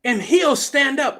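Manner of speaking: high, loud, defiant preacher; fired-up pulpit shout; full-force preaching. Real, not acted. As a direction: proclaim it boldly to the congregation.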